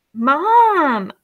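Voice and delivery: high, loud drawn-out whine; loud whining plea to Mom. A narrator's reading. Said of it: "whined Janie".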